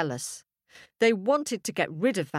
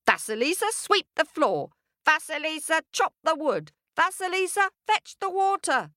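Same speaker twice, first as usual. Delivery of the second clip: rising higher with each bossy order; bossy stepfamily; 10 semitones higher than usual. Acted. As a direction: bark the chores, pitch climbing. Stepfamily barking chores.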